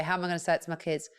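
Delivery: ordinary talk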